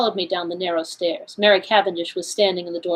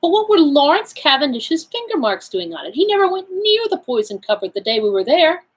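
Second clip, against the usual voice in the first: higher-pitched, incredulous questioning; astonished exclamation; 8 semitones higher than usual. A narrator's reading. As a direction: protest in astonishment, pitch raised. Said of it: "I exclaimed".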